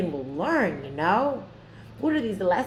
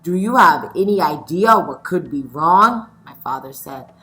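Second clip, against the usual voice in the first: lively read-aloud storytelling; story reading; 5 semitones lower, 7 dB louder than usual. A narrator's reading. Teacher reading a picture book aloud to her scholars, lively.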